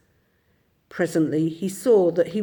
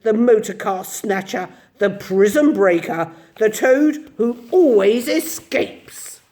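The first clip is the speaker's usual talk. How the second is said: louder, slowing grand boast; reckless boast; 4 semitones higher, 6 dB louder than usual. A narrator's reading. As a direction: crow about who he really is. Toad boasting wildly.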